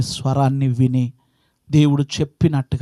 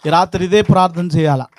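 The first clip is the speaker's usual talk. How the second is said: ordinary delivery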